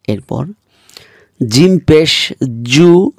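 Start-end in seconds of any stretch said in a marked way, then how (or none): 1.5-3.1 জু said firmly and slowly; slow, hard জিম পেশ জু; জু pressed out firmly. Shown as a demonstration. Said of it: "শক্তভাবে উচ্চারণ করতে হবে".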